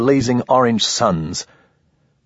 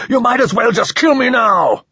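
high, loud scream begging for death; desperate scream; 14 semitones higher, 4 dB louder than usual. A narrator's reading. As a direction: scream it at the captor, much higher. Prisoner screaming at alien captor.

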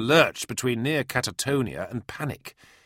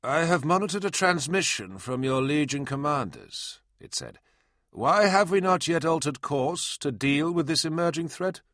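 mechanical voice; 8 semitones higher than usual. Acted. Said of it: "a mechanical voice cut across".

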